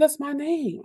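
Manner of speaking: high-pitched mimicked disbelief; lightly voiced wondering kid; amazed pupil. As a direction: play a child astonished at their own grade. Retelling pupil amazed at his grade.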